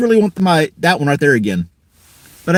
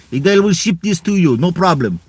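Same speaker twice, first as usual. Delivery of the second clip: put-on Russian accent. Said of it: "You do not like my Russian accent".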